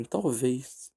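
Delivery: normal talking voice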